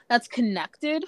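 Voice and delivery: usual speaking voice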